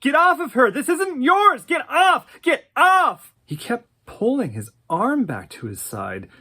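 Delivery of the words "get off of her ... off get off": very high, loud shouts; frantic shouting. Retold or imitated. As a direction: yank him off while yelling. Celia yanks Sam off silhouette.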